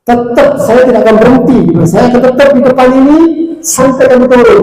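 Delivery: loud, high, insistent demand; insistent shout. Retold or imitated. Angel of death insisting the rich man come down, loud and forceful. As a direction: refuse to budge, raise your voice.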